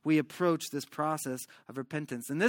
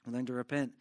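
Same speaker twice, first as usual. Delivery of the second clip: soft repeat; 5 semitones lower, 7 dB quieter, faster than usual. Shown as a demonstration. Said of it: "write this down".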